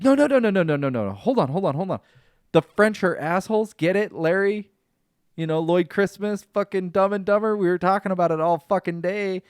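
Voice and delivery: loud, high, rushed pleading; panicked over-explaining to an offended girlfriend; frantic backpedaling. Retold or imitated. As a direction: scramble to take it back, loud and high.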